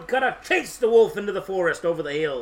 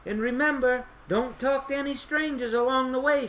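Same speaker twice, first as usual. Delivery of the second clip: higher-pitched cautioning mother; mommy's warning; 7 semitones higher than usual. A narrator's reading. Mommy sending her child off to Grandma's, cautioning. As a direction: voice a mother giving a reminder. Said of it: "said Mommy".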